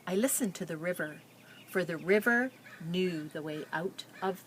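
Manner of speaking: put-on reading a picture book aloud to children; story narration. A narrator's reading. Teacher reading a refugee girl's story to her class, storytelling. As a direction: tell it gently, like reading to a class.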